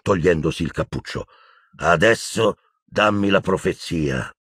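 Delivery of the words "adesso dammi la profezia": slow, condescending drawl; unhurried, patronising command. Acted. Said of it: "disse con voce strascicata".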